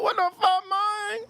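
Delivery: very high squeaky mock voice of friend; imitating friend. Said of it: "He goes".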